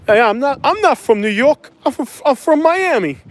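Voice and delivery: high-pitched, hammed-up New York denial; mock New York accent. New Yorker claiming to be from Miami, high mock accent. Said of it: "your accent's gonna give you up".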